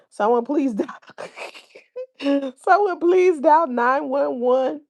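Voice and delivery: laughing through the read, climbing high and loud; high-pitched, laughing read-aloud; cracking up. Real, not acted. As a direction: break into laughter, then push it out high, loud and drawn out. Cracking up over chat comment.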